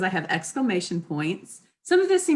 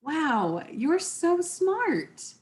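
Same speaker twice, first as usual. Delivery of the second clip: drawn-out, rising awe, slowly; a genuine, slow wow of admiration; sincere impressed; 9 semitones higher, much slower than usual. Shown as a demonstration. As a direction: gush it like you mean it. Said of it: "It could be read".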